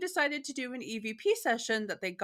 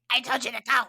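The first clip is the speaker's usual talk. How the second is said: a light mock goblin voice, a bit louder; creepy goblin voice; 2 semitones higher, 7 dB louder, faster than usual. Imitating the EVP clip for her cohost, mock creepy goblin voice. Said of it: "It's a creepy little goblin voice".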